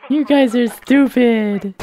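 drawn-out mocking taunt; slowly mock the guards. Real, not acted. Player taunting the city guards, slow and mocking.

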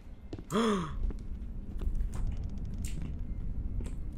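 sharp unvoiced gasp. Real, not acted. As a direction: gasp in shock. Startled gasp.

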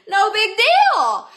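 loud, high, drawn-out singsong; showy brush-off. Real, not acted. Ketone dealer just opened a new one, showy brush-off. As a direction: belt it out slowly, making light of the mess. Brushing off the spill after reopening.

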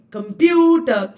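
Computer with a crisp, strong PU; clearer stressed syllable. Shown as a demonstration. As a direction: articulate comPUter clearly on the middle beat. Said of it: "It is said more clearly".

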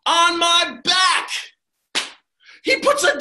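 loud, slow strained push; effortful. Acted. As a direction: heave it out as he lifts the donkey. Jack heaves the donkey.

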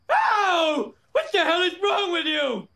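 furious yell; angry shout. Acted. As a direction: shout it in outrage. Homer yelling at stuntman.

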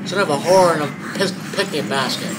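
loud, high, terrified screaming; panicking gamer. Real, not acted. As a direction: shriek it while being chased. Chased by monster in game.

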